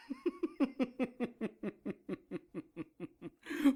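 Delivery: a high, rising laugh; triumphant laugh. Acted. Kay laughing as he wins, high and rising. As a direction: laugh in victory.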